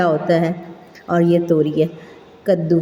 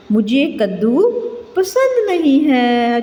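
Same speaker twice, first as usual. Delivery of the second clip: put-on child's answer; 4 semitones higher than usual. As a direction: play a child who dislikes pumpkin. Teacher voices a child's answer. (बच्चे की आवाज़ में, ऊँची और धीमी)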